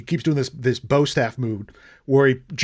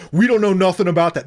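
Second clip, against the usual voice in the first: higher, louder retold protest; mock loud denial; 4 semitones higher, 5 dB louder than usual. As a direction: play dumb loudly, as an imitation. Acted denial by the scammers.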